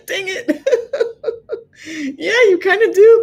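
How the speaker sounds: high-pitched words broken by laughter; real amused laughter. Real, not acted. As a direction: laugh helplessly as you concede. Laughing at chat about accent.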